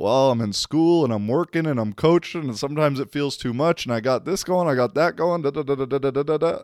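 a hint of an overloaded young coach venting; rambling spiel. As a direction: lightly imitate someone over-explaining their busy life. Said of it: "went into a full spiel about".

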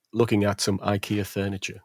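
ordinary delivery

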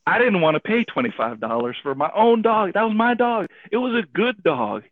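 retold indignant protest, rising in pitch; mad. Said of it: "And I was mad".

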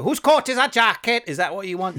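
put-on high, loud, broad Welsh accent. Actor hamming it up for casting directors, broad Welsh accent. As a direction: exaggerate a stage Welsh accent. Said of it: "I'd have to put on a Welsh accent".

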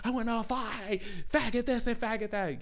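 high, strained mock rant; mock raging drunk girl. Imitating the drunk girl at the bar who wants to fight.

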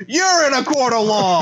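high, loud courtroom yell; shouting judge. Pretend. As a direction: bellow it at the defendant. Judge yells the repeat.

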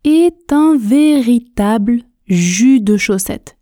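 slow, carefully articulated model sentence; tortoise speed. Shown as a demonstration. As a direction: say it slowly, every syllable distinct. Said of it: "la vitesse tortue, lentement, en articulant bien". (vitesse tortue, lentement et bien articulé)

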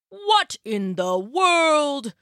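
slow, drawn-out amazement; puzzled old husband, words dragged out; astonished. Acted. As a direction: stretch it out in baffled wonder. Old man amazed by a find.

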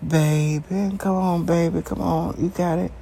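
mock tender encouragement, cooing; sweet coaxing. Mocking a soft lover he refuses to be. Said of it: "I don't go talk like no".

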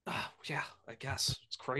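hushed whispered aside. Real, not acted. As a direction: breathe it under your breath. Quiet aside after teasing.

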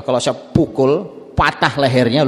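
slowed, drawn-out joking threat; slow teasing Umar. Acted. Umar joking to the Prophet, slow and teasing. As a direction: tease it out slowly with a rising end. Umar's joke, first telling.

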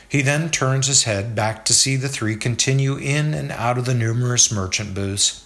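plain reading voice

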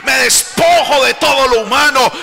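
climbing to a loud, high preaching cry; king's repentant prayer, shouted over the music. Acted. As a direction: pour out the king's surrender at full force. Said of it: "como queriendo decir".

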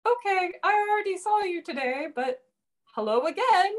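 high, lightly voiced bemused colleague; a colleague gently pointing out the double hello; amused coworker. Japanese coworker greeted twice, mildly amused high voice. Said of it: "they're just kind of like".

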